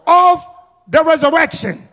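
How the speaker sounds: loud, high, driving sermon delivery; full-force preaching. Real, not acted. Pastor testifying to the congregation about resurrection power, fervent preaching. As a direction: preach it hard, phrase by punched phrase.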